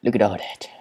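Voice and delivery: ordinary delivery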